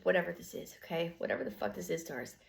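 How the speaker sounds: ordinary delivery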